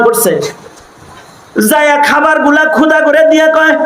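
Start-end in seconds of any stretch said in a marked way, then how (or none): none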